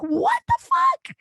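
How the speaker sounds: squeaked very high and slow in disbelief; incredulous exasperation. Real, not acted. Exasperated at dad.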